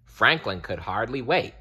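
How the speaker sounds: plain reading voice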